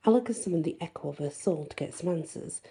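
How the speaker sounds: ordinary delivery, nothing marked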